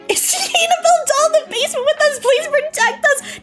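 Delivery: mock fear.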